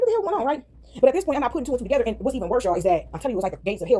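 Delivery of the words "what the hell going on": high-pitched baffled question; imitated incredulity, pitched up but not shouted; retold confusion. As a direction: act out bewilderment with a raised pitch.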